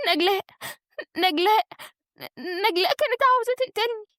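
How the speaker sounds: high, halting distressed voice; tearful breakdown. A narrator's reading. Wife tells husband someone tried killing her, distraught. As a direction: break the words up with sobbing pauses. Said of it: "وهي بتبكي ومنهارة".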